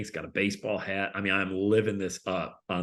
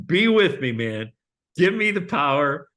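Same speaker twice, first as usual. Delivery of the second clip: loud, slow pleading to God; mock desperate prayer, raised voice; fervent prayer; 4 semitones higher, 7 dB louder, slower than usual. Speaker in green room praying, loud pleading. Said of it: "so I just said".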